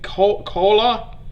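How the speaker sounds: slow, questioning "Ko-, Kola?" with a hard K; unsure pronunciation. Real, not acted. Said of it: "please correct how I pronunciate it".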